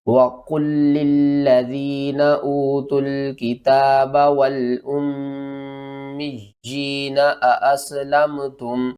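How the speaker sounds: slow, melodic tajweed chant; slow Quran recitation. Shown as a demonstration. Teacher recites the verse to the class, slow chant. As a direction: recite the verse slowly and evenly.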